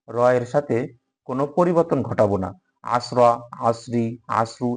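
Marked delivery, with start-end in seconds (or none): 3.0-4.7 আসরা, আসরি, আসরু each with a final vowel; wrongly added vowels. Shown as a demonstration. Teacher listing changes students must avoid, vowel endings on আসরা, আসরি, আসরু. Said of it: "এরকম কোনো পরিবর্তন নেই".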